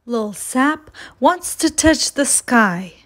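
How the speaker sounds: ordinary delivery, nothing marked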